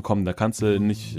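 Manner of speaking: ordinary talk, nothing marked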